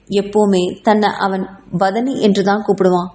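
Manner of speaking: plain reading voice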